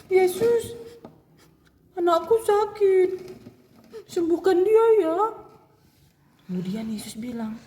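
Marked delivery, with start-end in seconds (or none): 0.1-5.2 high, loud tearful mother; mother begs Jesus to heal her daughter, high and pleading. Acted. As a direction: beg for your child, voice high.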